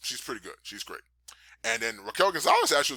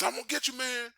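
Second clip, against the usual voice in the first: much higher, frightened mock threat; mock scared; 12 semitones higher than usual. Imitating a wrestler fear walking toward danger, mock scared. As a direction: threaten while pretending to be terrified. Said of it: "you talk and you're scared".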